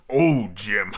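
low, laughing pirate impression; half-joking low villain drawl; a chuckling old pirate; Tim Curry. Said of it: "I can't do a Tim Curry".

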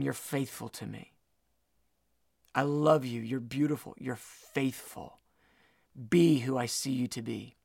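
low, soft, gentle declaration of love; hushed voice of a loving God; tender reassurance. Acted. Voicing God's tender reassurance.

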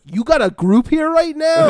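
high, loud mock disbelief; incredulous question. [name] reacts to Giorgio's news, incredulous.

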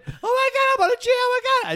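very high panicked squeal; hammed-up frantic wife; imitated screaming. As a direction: squeal it in mock hysterics. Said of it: "And she's screaming".

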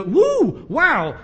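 whooping, high and loud at the start; an exclamation of mock amazement; excited. Preacher imagines God reacting to Abraham, excited.

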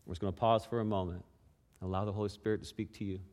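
soft, hushed prayer voice. Acted. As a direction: pray quietly over the room. Leads a quiet prayer.

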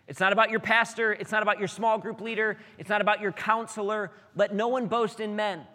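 loud, high, hammering each line; full-force preaching. Real, not acted. Pastor exhorting his congregation at full force. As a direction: drive it home to the crowd.

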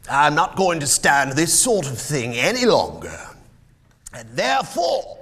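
loud, high-rising feigned outrage; mock indignation. Scrooge pretending to sack Bob, feigned outrage. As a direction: build a mock scolding to a high peak.

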